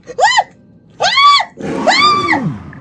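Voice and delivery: three very high, loud screams of terror; terrified screams. Real, not acted. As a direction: scream in real fright as it attacks. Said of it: "I'm sorry for screaming".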